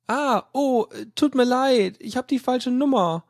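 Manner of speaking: high, flustered apology; a flustered caller apologizing to the angry operator. Acted. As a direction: stammer it out like a caught student. Caught caller stammers apology.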